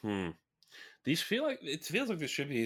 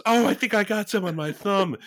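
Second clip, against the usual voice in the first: squeamish high voice; 12 semitones higher, 7 dB louder than usual. Retold or imitated. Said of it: "And she goes".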